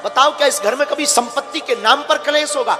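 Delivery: impassioned preaching. Real, not acted. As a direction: drive each question out at the congregation. Preacher puts rhetorical questions to the audience. (जोशीला, ऊँचे स्वर का प्रवचन)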